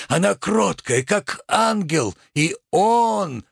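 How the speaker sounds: high, slow tragedian's exaltation; theatrical indignation. Acted. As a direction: lift the voice and draw out the key words. Tragedian praises woman, indignant at merchant.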